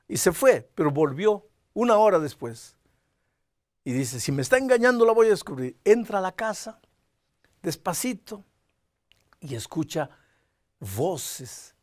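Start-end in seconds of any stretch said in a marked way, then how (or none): 4.2-5.8 loud, higher suspicious vow; jealous threat. Retold or imitated. Jealous husband vows to catch his wife, loud and threatening. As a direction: catch her in the act.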